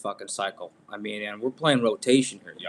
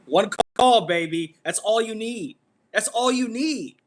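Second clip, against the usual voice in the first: hyped up; 9 semitones higher, 6 dB louder than usual. Real, not acted. Hyped defending his trade.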